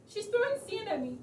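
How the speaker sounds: whiny complaint. Acted. Complaining to husband.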